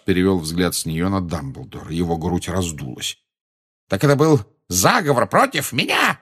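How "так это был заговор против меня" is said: high, outraged cry; yelling. A narrator's reading. Minister accuses Dumbledore of plotting, yelling. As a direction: cry out the accusation in a raised pitch. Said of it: "завопил он".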